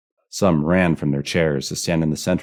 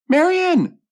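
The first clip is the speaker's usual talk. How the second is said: extremely high, loud scream of the name; frantic piercing call; alarmed shout; 10 dB louder than usual. A narrator's reading. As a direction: yell for someone missing. Louise searching for missing Marion.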